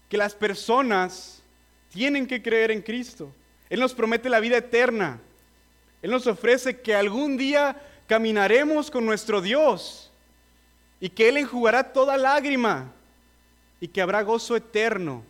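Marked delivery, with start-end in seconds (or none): 3.7-15.2 building, higher and louder preaching swell. Acted. Preacher swelling as he tells listeners of eternal life. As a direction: lift them toward the promise of joy. Rising gospel promise to listeners.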